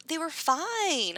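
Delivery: high, slow, drawn-out mock of an owner; slow, high imitation of a clueless owner; mock dog owner. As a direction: stretch it out high like a naive owner.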